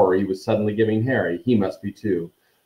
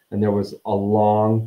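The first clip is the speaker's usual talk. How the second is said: ordinary delivery, nothing marked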